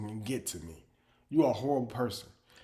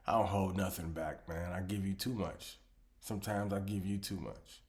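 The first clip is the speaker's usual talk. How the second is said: ordinary delivery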